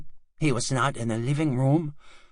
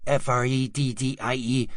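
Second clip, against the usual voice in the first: ordinary delivery, nothing marked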